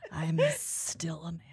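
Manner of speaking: breathy joking whisper. Acted. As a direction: whisper it as a joke. Whispered joke.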